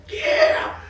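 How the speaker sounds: high, loud whooping yeah; acted burst of fan excitement; excited fan yell. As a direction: let out a shrill-pitched cheer of a yeah. Acts out excited busboy greeting.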